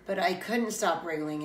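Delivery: ordinary delivery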